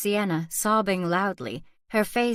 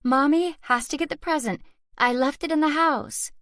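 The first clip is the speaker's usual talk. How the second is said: tense reassurance; 8 semitones higher than usual. A narrator's reading. Mother pleads with frightened child.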